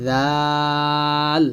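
জাল with tongue tip at the teeth edge, stretched out; thin, lisped "dhaal" for জাল; soft, long zal. Shown as a demonstration. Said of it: "নরম আওয়াজে বেশি টান".

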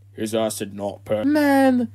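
ordinary delivery

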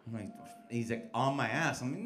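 ordinary delivery

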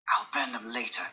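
unvoiced whispered thought; secretive whisper. Dorian hides Basil's coat.

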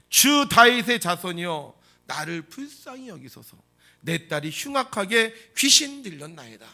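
loud, stretched-out formal plea; 소리치는 간청. Acted. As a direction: cry out in solemn old-style words. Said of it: "예수님을 향하여 소리칩니다". (크게 외치는 옛말투의 간청)

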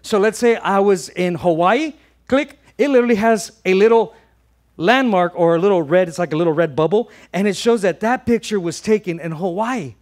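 loud, high, energized preaching. Acted. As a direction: lift the congregation with big energy.

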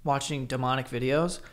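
low, soft and tentative; hushed retold question. Host quoting partner asking about demonic videos, hushed. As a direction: quote her in a low hush.

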